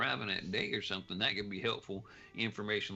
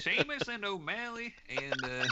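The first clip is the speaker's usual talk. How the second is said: higher-pitched Irish lilt; Irish accent; 10 semitones higher than usual. Retold or imitated. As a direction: put on a light Irish brogue. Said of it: "they all sound like that".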